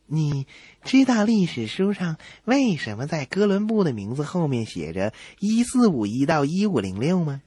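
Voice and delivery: ordinary talk, nothing marked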